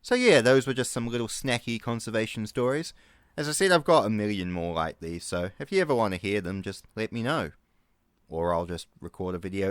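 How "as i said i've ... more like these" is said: usual speaking voice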